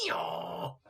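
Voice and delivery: breathy mock donkey bray. A narrator's reading. Donkey kicking the robber, a breathy bray.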